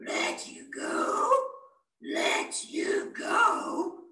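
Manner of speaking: slow, loud, scornful echo; mocking lion. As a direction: scoff at the little mouse. Lion mocks the plea.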